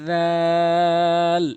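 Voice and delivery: জাল as dhaal, vowel held very long and loud; three-alif stretch. Shown as a demonstration. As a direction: stretch the middle of জাল slowly for three counts. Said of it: "এভাবে উচ্চারণ করবেন".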